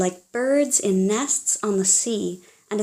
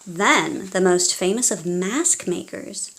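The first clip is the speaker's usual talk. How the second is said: ordinary delivery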